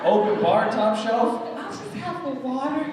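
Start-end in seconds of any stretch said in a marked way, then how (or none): 1.6-2.8 high, prim polite order; meek sober order. Retold or imitated. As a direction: order water like a saint.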